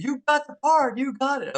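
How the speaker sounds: high, bright retold agent call; excited agent. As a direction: play the thrilled agent on the phone. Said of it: "It's Linda, the agent".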